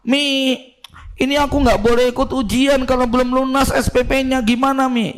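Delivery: high, pleading schoolgirl on the phone; whiny daughter. Retold or imitated. Santriwati begging her mother on the phone, high and pleading. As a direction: beg mum for the fee money, rising high.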